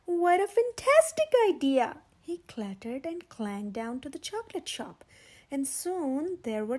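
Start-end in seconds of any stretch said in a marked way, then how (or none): 0.0-2.0 high, loud, laughing exclamation; Mister Steele bellowing happily after tasting the mouse; laughing joy. A narrator's reading. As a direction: boom it out with a big laugh. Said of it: "ate his mouse and laughed".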